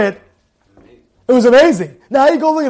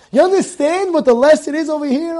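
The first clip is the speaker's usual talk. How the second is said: usual speaking voice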